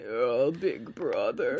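put-on slow, drawn-out eerie little girl voice; pitiful chimera girl. Nina chimera voiced in recap.

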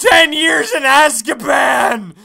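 loud, slow, hammed-up cry of suffering; mock dramatic shout. Real, not acted. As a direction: wail it like a martyr, half joking.

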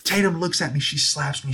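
ordinary delivery

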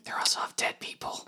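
a whispered teasing aside. Real, not acted. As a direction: lean in and whisper it.

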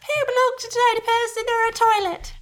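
put-on high, loud childlike repeat; mock child voice. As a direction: parrot it back like a small child. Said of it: "repeat after me".

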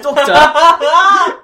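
loud high Doctor yell; Marty calling Doctor. Retold or imitated. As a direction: belt it out like Marty. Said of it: "Marty always refers to him as Doctor".

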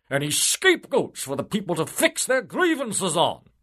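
high, loud, indignant bureaucrat; pompous official. Acted. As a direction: puff up like a smug politician. Civil servant asks eagerly.

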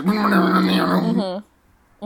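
imitated grinding whir, whispery; garbage disposal noise. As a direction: make the hum of a garbage disposal. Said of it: "That was really good Foley work".

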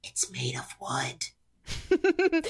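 high breathy whisper. Acted. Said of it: "Kick is whispering this to you".

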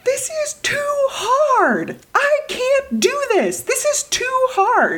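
put-on high, slow, crying whine of a frustrated kid; tearful child's cry; child's tantrum. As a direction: wail it like a child who gives up. Child's tantrum, voiced by mother.

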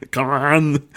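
lightly done Austrian action-hero echo; Arnold Schwarzenegger. Acted. Laughing along with co-host, light Schwarzenegger impression. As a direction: echo it in a mock commando voice. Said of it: "Arnold Schwarzenegger".

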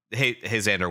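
normal talking voice

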